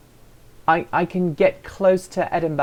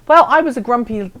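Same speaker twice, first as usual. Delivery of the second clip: ordinary delivery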